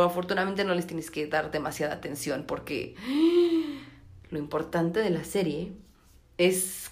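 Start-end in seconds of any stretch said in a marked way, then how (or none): 3.0-3.8 sharp high gasp; mock gasp. Reacción al final de serie. (jadeo exagerado de sorpresa)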